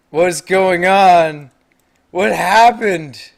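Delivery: slow, high cry of bewilderment; stretched-out shouted shock; loud disbelief. Real, not acted. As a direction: wail it out, loud and drawn out. Shocked at what happened in game.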